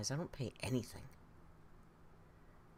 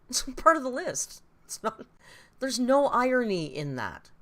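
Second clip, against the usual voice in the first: laughing; 8 semitones higher, 10 dB louder than usual. Real, not acted. Laughing correction of the friend.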